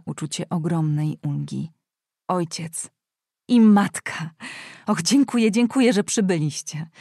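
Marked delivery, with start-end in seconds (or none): none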